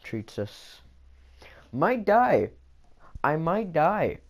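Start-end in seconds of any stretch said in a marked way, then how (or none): 1.7-2.5 dramatic squeak. Acted. Dramatic doom in a game.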